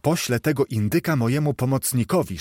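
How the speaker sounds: plain reading voice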